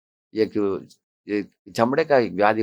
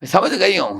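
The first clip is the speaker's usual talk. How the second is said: higher mock shout; wife yelling back; 10 semitones higher than usual. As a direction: imitate the wife snapping back in a yell. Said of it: "ऐसे वो चिल्लाएगी". (पत्नी का चिल्लाकर जवाब)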